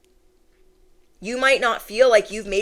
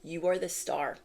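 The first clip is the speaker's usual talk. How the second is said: much softer You are the star; 3 semitones lower, 10 dB quieter than usual. Shown as a demonstration. Reader affirms viewer, soft. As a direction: say it softly to yourself.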